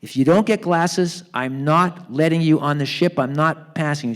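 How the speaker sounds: loud, exasperated telling-off; getting madder. Retold or imitated. Doctor finally losing patience with Harry, mad. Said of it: "the doctor's getting madder and madder".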